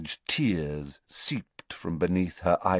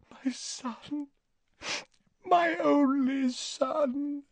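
higher, slow and softly broken; grieving mother; 5 dB quieter, much slower than usual. Acted. Narcissa mourns her son to Snape, higher and slow. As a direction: let it break on the son.

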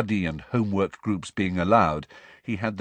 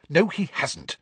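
snapped; 8 semitones higher than usual. A narrator's reading. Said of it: "snapped Hermione".

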